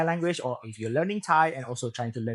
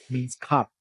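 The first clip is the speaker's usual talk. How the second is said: ordinary delivery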